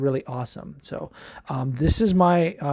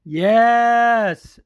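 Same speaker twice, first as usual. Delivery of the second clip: excited yes; 8 semitones higher, 7 dB louder than usual. Real, not acted. Greeting a fan's message.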